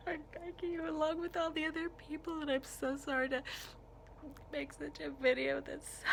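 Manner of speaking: begging while crying, voice lifted and breaking; real crying. Real, not acted. Vlogger begging politicians for gun laws, crying for real. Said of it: "where I'm bawling my eyes out".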